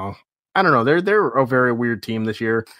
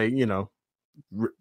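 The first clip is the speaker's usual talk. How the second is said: ordinary delivery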